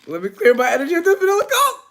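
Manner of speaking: high, loud and quick, like a joyful cry; excited shout. Real, not acted. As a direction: belt it out gleefully, going up at the end. Same line shouted up high.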